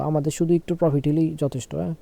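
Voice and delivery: normal talking voice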